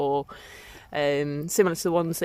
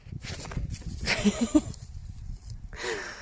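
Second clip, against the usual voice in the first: low soft chuckle; 6 semitones lower, 8 dB quieter than usual. Real, not acted. Alpaca pushes her around.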